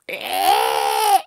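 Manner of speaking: low, loud honking cry; loud ostrich squawk. Acted. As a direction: blast a silly bird squawk. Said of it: "the ostrich is like".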